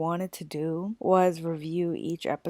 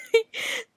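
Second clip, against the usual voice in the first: breathy laugh. Real, not acted. Laugh continues.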